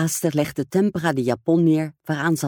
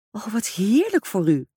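exclaiming; 7 semitones higher than usual. Acted. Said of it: "riep het meisje uit".

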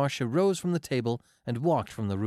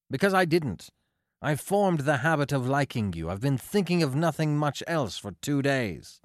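put-on raised, heated young man's declaration; fiery outburst; 5 semitones higher, 4 dB louder than usual. A narrator's reading. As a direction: let it blaze out, higher and louder. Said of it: "Fire blazed suddenly from the grey forests".

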